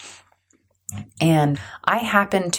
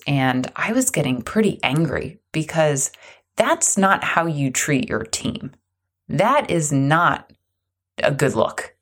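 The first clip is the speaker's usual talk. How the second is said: ordinary delivery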